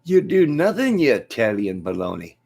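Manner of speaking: high, loud Irish jeer; hammed-up fighter's taunt; McGregor. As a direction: burst out high, then drop for the insult.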